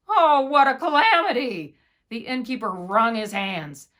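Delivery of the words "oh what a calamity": loud, higher wail of dismay; dismayed cry. A narrator's reading. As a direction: cry out in horror. Innkeeper panics over dead grandmother.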